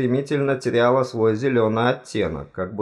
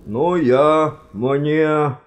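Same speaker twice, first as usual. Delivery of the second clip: louder, higher stammered start; through locked teeth; 6 semitones higher, 5 dB louder, much slower than usual. A narrator's reading. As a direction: struggle to get words past a clenched jaw. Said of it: "удалось выдавить сквозь них несколько слов". (с трудом, сквозь сжатые зубы)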